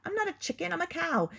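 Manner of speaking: squeaky insistent denial; offended cow voice; indignant cow. Acted. Cow protests being chicken.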